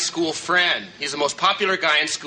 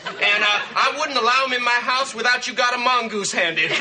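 Jimmy Carter; 5 semitones higher than usual. Acted. Jack disguises his voice to call Janet, fake Southern accent. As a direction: put on a hammed-up folksy Southern accent, pitched well up. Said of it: "I was doing Jimmy Carter".

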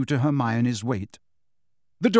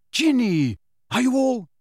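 urgent call; 9 semitones higher than usual. A narrator's reading. Harry calling to injured friends.

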